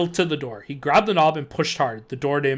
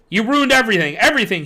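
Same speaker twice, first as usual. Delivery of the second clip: loud, raised furious repetition; angry accusation; 6 semitones higher, 7 dB louder than usual. Acted. Janitor advancing on the kids, loud furious accusation. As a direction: hurl the blame at them.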